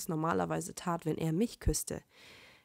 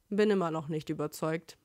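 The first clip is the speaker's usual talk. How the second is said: bored; 3 semitones lower than usual. A narrator's reading. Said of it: "meinte er gelangweilt".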